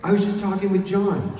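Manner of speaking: high, loud eager tone; eager news. Retold or imitated. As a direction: share exciting news. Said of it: "And he goes".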